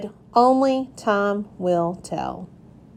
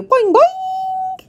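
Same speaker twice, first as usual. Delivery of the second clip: much higher, springy frog-like boing; fabulous froggy boing. A narrator's reading. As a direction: bounce it out like a proud frog leaping. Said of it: "in my fabulous froggy way".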